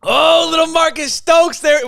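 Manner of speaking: high, slow, delighted shout; light up at hearing him; excited shout. Real, not acted. Hears the recruit laugh on the call.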